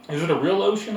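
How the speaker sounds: put-on a small boy asking eagerly; young boy. A narrator's reading. Boy voiced in story.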